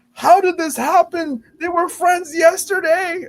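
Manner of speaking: high, loud, slow wail of disbelief; hammed-up bewildered outcry; mock shock. Imitates baffled reaction to fights.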